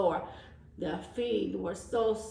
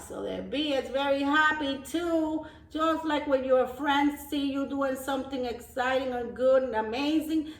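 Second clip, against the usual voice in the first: ordinary delivery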